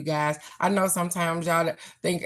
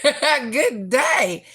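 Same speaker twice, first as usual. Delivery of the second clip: laughing; 6 semitones higher, 4 dB louder than usual. Real, not acted. Laughing while greeting viewer.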